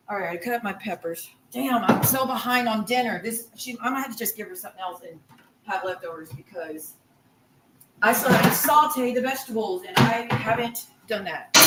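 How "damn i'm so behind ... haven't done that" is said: ordinary talk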